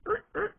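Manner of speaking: high squeaky jar-twisting sound effect; imitated squeaking noise. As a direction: squeak out two short mock twisting noises. Mimes putting balls in a jar.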